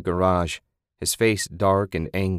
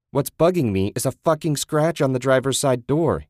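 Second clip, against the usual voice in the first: louder, higher angry snap; 5 semitones higher, 4 dB louder than usual. Acted. Ted confronting Susan over the scratched car, angry. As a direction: hit him with the accusation, furious. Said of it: "he said angrily".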